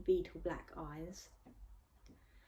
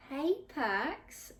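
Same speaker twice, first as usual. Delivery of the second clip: higher, syrupy flirting greeting; sickly sweet tone; 8 semitones higher than usual. A narrator's reading. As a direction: coo his name to win him over. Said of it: "she said in a sickly tone".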